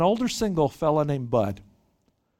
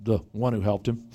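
reluctant; 7 semitones lower than usual. Retold or imitated. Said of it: "they can barely even say the word".

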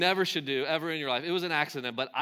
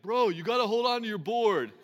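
big angry snowboarder; 5 semitones higher than usual. Retold or imitated. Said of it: "And he's like".

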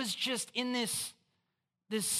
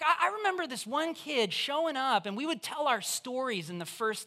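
ordinary delivery

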